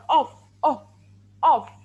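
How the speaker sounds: each "off" a separate single beat; isolated, emphatic "off"; one sound. Shown as a demonstration. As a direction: punch out "off" loudly as one syllable, repeated. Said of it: "one sound in one part".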